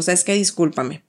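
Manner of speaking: quiet, tender apology to her son; soft apology. Retold or imitated. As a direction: softly say sorry to the child. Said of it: "Lo agarro, le digo".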